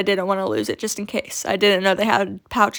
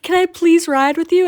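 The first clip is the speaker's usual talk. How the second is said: higher and louder, lightly voiced eager girl; eager kid asking; 7 semitones higher, 5 dB louder than usual. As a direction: plead brightly like a little kid. Girl asks to ride along.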